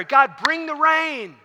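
high, drawn-out plea to God; fervent preacher acting out a prayer; begging in prayer. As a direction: plead slowly and urgently, pitched well up. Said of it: "he's begging God in prayer".

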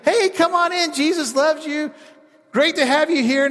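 hammed-up friendly welcome at the church door; mock welcoming churchgoer. Preacher imitating a churchgoer's fake welcome. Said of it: "be like".

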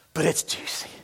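hushed tempting aside; conspiratorial whisper. Retold or imitated. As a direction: whisper it like juicy gossip.

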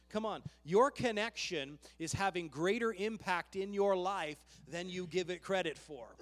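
ordinary delivery

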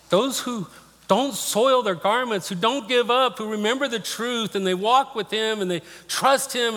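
high-pitched, emotional preaching cry; impassioned preaching. Real, not acted. Preacher assuring congregation he will see his mom again, impassioned. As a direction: proclaim it with full conviction through the emotion. Preaches at full force after the testimony.

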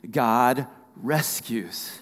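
"God rescues" drawn out very slowly, word by word; call to repeat. Shown as a demonstration. Preacher leading the congregation to say it with him, drawn out. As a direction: stretch "God" and "rescues" for others to join in. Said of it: "say this with me".

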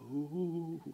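soft, airy "ooh" of amazement; hushed ooh stepping outside after quarantine; breathy sigh of wonder. Retold or imitated. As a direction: exhale in awe at the open air. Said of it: "it was like".

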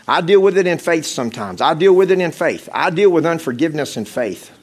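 loud, driving pulpit preaching to the congregation; fervent preaching. Acted. Preacher urging the congregation forward, fervent and loud. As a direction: push the altar call hard with full force.

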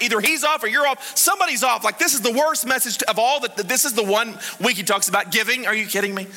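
high-pitched indignant rant; retold anger. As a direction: complain in exasperated disbelief, hammed up. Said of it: "I was so mad".

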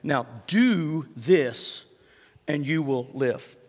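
slow each word, do this; Jesus telling the expert what to do, slowly; slow, deliberate instruction. Retold or imitated. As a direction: lay it out slowly and firmly. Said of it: "He says".

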